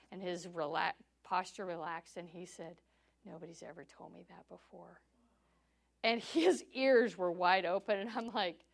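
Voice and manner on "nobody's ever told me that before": very soft retold reply; quietly moved, in retelling; hushed astonishment. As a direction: quote him softly, taken aback. Retelling man's awed reply.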